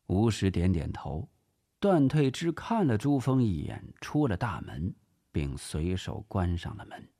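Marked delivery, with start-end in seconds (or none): none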